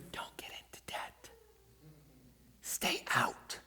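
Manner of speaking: secret whisper; whispered clue. Acted. Pastor whispering a big clue to the congregation, unvoiced. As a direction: hush it like a secret tip.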